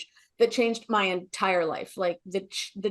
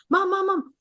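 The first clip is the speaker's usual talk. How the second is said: imitating confused son; 7 semitones higher than usual. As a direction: call to mom like a bewildered little boy. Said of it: "Like, genuinely confused".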